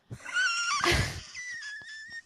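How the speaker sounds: squealing burst of laughter; high shrieking laugh. Real, not acted. Teasing friend laughs hard.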